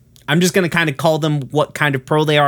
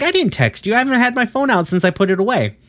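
much higher and fast, a defensive rush; flustered Pearl; 10 semitones higher, much faster than usual. Retold or imitated. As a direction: blurt it out quickly and high, denying everything. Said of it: "She's like".

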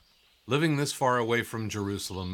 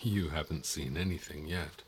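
lower, softer mutter to himself; muttered aside; 5 semitones lower, 6 dB quieter than usual. Acted. Guide hints at a secret while the son isn't meant to hear, muttered. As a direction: say it half under your breath. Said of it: "What was that?".

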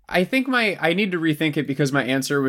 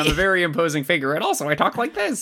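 imposing fighter impression; 3 semitones higher than usual. Imposing figure explains how he talks, high mock-tough voice. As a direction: voice climbing into a high-pitched put-on. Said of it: "he kinda has the Brock Lesnar thing".

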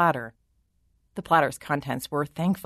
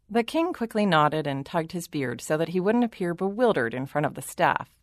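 ordinary delivery

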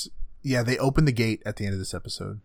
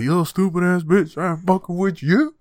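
high, loud put-on insult voice; mock taunting; 7 semitones higher, 5 dB louder than usual. Co-host play-acting the character's retort, mock taunt. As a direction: hurl it as a high-pitched jeer.

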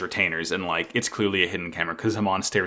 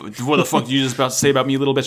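angry retort; 4 semitones higher, faster than usual. Retold or imitated. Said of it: "Dude gets pissed".

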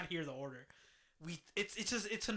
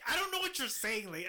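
high, loud exasperated outburst; mock-blow up at the cashier who won't listen; exasperated retelling; 6 semitones higher, 5 dB louder than usual. Retelling the drive-thru mix-up to friends, high exasperated outburst.

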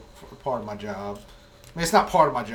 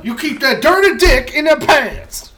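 mock scolding shout; 15 dB louder than usual. Hammed-up scolding joke.

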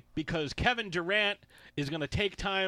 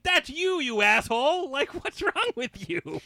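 much higher, louder exasperated call-out; mock outrage; 9 semitones higher, 5 dB louder than usual. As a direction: call out his burner account. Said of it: "It's like".

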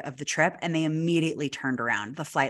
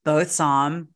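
usual speaking voice

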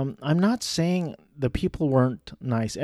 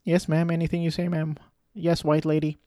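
higher, overly eager yes-ma'am voice; mock subservient; 5 semitones higher than usual. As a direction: play the fawning guest, pitched up. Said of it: "I didn't say that. I was like".

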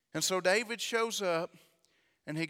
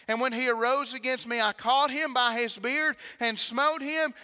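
loud, higher preaching climb; preaching at full force; 5 semitones higher, 7 dB louder than usual. Acted. Preacher building to the congregation, loud and high. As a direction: drive it home to the congregation.

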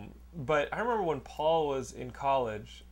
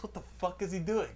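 hushed, puzzled question; muttered bafflement; 7 dB quieter than usual. Retold or imitated. Brother wondering about the stomping roommate, muttered bafflement. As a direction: ask yourself quietly, baffled.

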